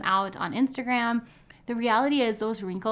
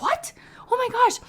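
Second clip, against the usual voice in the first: high shocked squeal, much higher pitch; 4 dB louder than usual. Retold or imitated. Retelling her shock at the makeup artist, high squeal. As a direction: yelp in disbelief like it just happened. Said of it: "And I was like".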